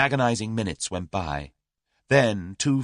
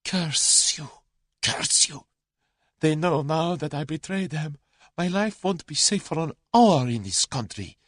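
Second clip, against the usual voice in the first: higher, bitter outburst; vehement outburst; 7 semitones higher than usual. A narrator's reading. The Russian rages at Julius, vehement. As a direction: spit the curse in desperation. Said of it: "He burst out vehemently".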